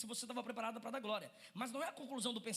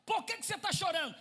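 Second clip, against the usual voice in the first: higher urgent shout; worried husband; 7 semitones higher, 7 dB louder than usual. Acted. Husband pressing his crying wife, an urgent shout. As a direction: demand it with concern. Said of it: "Olha o que que ele tá dizendo".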